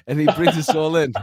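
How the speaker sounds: ordinary delivery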